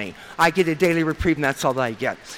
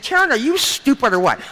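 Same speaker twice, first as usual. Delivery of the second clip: higher, louder mock scold; exasperated sponsor; 7 semitones higher, 4 dB louder than usual. Sponsor scolding the newcomer Karen, in a higher mock-exasperated voice. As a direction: toss it off like a blunt, teasing mentor.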